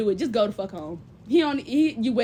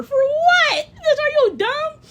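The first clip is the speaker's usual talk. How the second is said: high, louder, incredulous shout; outraged shout; 14 semitones higher, 6 dB louder, much slower than usual. Real, not acted. Answering a man who said work harder, outraged shout. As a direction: fire back in disbelief.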